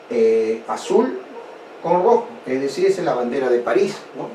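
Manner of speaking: ordinary delivery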